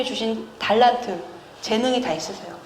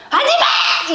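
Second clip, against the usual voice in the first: mock angry yell; 5 dB louder, faster than usual. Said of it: "이렇게, 이렇게 하는 거는".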